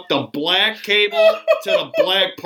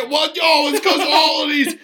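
high, blustering mock accusation; blaming her; 8 semitones higher than usual. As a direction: deflect blame in an indignant, raised pitch. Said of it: "immediately blame her".

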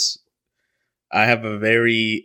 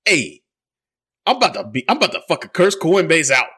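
higher, louder mock rant of an angry dad; imitating dad angry; 7 semitones higher, 4 dB louder than usual. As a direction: play the furious dad ranting about Coinbase, voice up and loud. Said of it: "my dad is, like, calling me".

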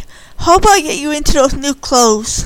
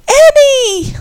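loud high holler; 7 dB louder than usual. Acted. Candy hollering for Annie in the morning, loud and high. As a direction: holler her name out. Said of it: "Candy hollered".

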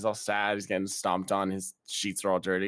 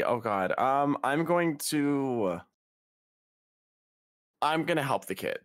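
normal talking voice

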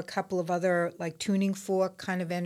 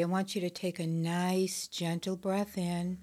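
soft spa whisper; 6 dB quieter than usual. Acted. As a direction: gently guide them into calm. Leads a guided meditation.

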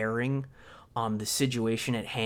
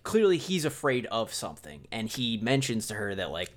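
ordinary talk, nothing marked

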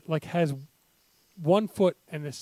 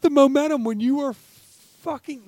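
high, loud disbelief; exasperated rant; 9 semitones higher, 6 dB louder than usual. Real, not acted. Ranting about the timeout.

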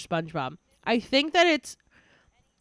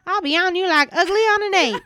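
higher, louder mock Texas twang; Texan drawl; 10 semitones higher, 10 dB louder than usual. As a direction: ham up Sandy's Texan accent. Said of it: "I could hear it in her voice".

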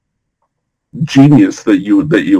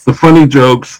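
ordinary talk, nothing marked

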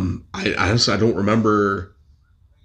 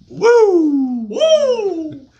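high, loud wrestler woo; Ric Flair woo; 6 dB louder than usual. Retold or imitated. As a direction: screech it like the Nature Boy.

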